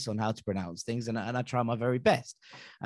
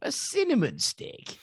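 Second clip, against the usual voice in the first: much higher, slow, exasperated outrage; outraged disbelief; slower than usual. Retold or imitated. Guest ranting to hosts about his cider, high and outraged. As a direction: pitch it way up in comic indignation over a cinnamon stick.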